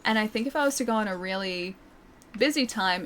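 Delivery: normal talking voice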